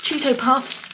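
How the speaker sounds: ordinary delivery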